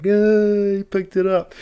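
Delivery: mock a hysterical child shrieking in distress; imitated crying scream. Kids wailing that the magic elf got touched, imitated crying scream. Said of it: "screaming, like, crying. They're like".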